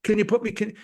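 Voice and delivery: high, mock-eager plea; eager begging. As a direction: imitate someone clamouring to be picked. Voices eager people begging for samples.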